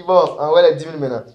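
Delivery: mock pastor calling for donations; hammed-up preacher collecting money; a hint of a greedy pastor; lightly voiced pastor. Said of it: "le pasteur, il dit".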